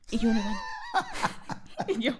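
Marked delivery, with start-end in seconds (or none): none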